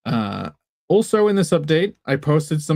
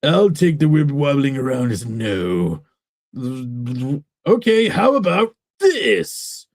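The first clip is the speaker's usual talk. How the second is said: character voice. Acted. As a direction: stretch the words out, climbing at the question. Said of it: "if that's his official voice".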